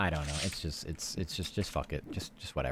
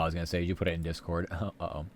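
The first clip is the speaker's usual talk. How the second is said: ordinary talk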